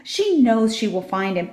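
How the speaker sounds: ordinary delivery, nothing marked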